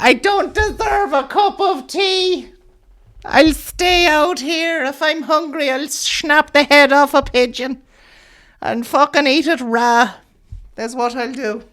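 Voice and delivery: high, slow, heartbroken eccentric lady; pigeon lady voice. Acted. Comic telling the audience, as a high batty pigeon lady. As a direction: pitch it way up and drag it out like a batty bird woman.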